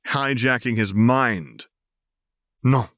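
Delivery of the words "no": a firm, final no; firm refusal. A narrator's reading. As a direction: allow no argument. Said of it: "Val replied firmly".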